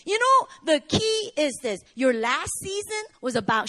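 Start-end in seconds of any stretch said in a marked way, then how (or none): none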